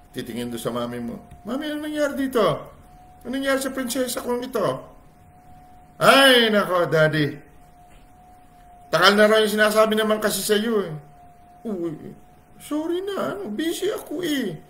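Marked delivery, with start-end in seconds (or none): 1.5-4.7 high, playful question; father asking. Retold or imitated. Father asking mother about their sad princess, high. 6.0-10.9 loud, high exasperated complaint; fed up, scolding the husband; complaining wife. Retold or imitated. Wife complaining to husband, loud and high. 11.6-14.6 sheepish apology. Retold or imitated. Father apologizing.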